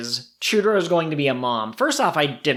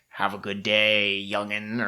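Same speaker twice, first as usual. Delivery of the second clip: put-on slow, lower, folksy old-timer voice; old dog; 5 semitones lower, 4 dB quieter, slower than usual. Host voicing Chutaro bidding the puppy farewell, low slow old-timer voice. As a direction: drawl it like a wise old dog. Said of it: "And Chutaro's like".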